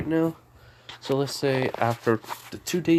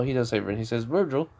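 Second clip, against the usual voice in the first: usual speaking voice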